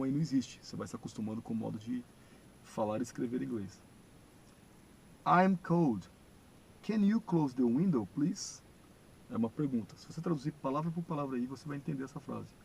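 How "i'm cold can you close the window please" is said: "I'm cold. Can you close the window, please?" higher, louder and slow; model pronunciation. Shown as a demonstration. Teacher reading the model question aloud, slow and clear. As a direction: spell out each word slowly for the class. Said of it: "É assim que pronuncia".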